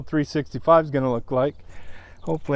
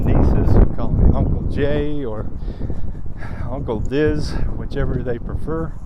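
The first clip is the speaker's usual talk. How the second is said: heartfelt plea; 5 dB louder than usual. Real, not acted.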